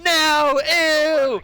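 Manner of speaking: high, loud drawn-out "ew"; hammed-up squeal of disgust; disgusted crowd. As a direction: cry out in comic revulsion. Everyone recoils in disgust.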